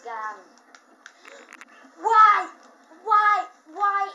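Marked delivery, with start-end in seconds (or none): 2.0-4.1 high, loud repeated why; frustrated wails. Real, not acted. Player wailing at the game that keeps killing him. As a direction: cry out each why louder.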